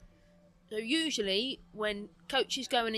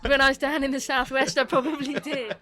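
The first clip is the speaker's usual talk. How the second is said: laughing loudly; 3 semitones higher, 12 dB louder than usual. Real, not acted. Laughing admission.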